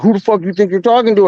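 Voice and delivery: imitating a drunk kid picking a fight; mock aggressive challenge. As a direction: rattle it off like a guy squaring up.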